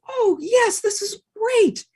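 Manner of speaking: high, thrilled voice; excited man; delighted man. Acted. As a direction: welcome the dog with joy. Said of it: "Man said".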